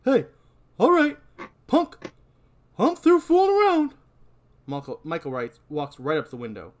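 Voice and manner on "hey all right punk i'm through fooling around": high, loud tough-cop threat. Acted. Deputy losing patience with the punk. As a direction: bark it like a fed-up cop.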